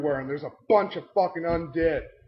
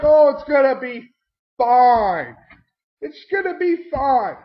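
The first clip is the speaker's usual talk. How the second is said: higher, louder mock-breezy it's fine; dismissive reassurance; 7 semitones higher, 7 dB louder, slower than usual. Mocking the party brushing off his warning. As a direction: brush off the worry. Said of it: "everybody's like".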